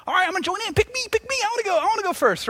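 put-on high-pitched eager kid voice; little Johnny. New student joins the game, high eager child voice. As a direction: pipe up like a child joining in. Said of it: "little Johnny, he's like".

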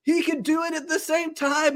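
high-pitched mock amazement; amazed onlookers. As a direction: imitate a stunned crowd reacting. Voices amazed players watching the move.

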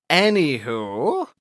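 ANYWHO stretched slowly, rising at the end; drawn-out segue. Pretend. Host moving on after an accent tangent, "Anywho" drawn out and rising. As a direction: draw out "Anywho" with a playful upward swoop.

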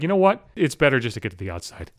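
lightly voiced walkers, dropping low and soft; resigned aside, sinking in pitch; walkers giving in. Walkers deciding to move out of the angry runner's way, conceding quietly. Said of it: "and they're like".

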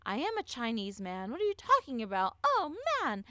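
high, squeaky protest of innocence; mock denial. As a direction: play the caught liar insisting he's innocent. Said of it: "But Nikolai was like".

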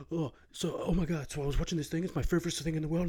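fast, high, gushing chatter; excited Bert impression. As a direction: rattle it off like an overexcited fan. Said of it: "So he'll be like".

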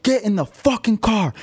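high mock bouncer yell; imitating a yelling bouncer; retold yell. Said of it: "is just yelling at me".

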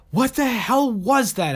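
high, loud shaken question; frazzled. A narrator's reading. Saradwan to the group after landing, high and frazzled. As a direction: blurt it, still rattled by the fall. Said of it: "still frazzled".

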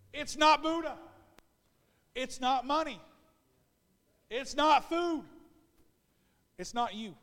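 loud, declaiming pulpit voice; fiery sermon proclamation; preaching at full force. Acted. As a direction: thunder the scripture at them.

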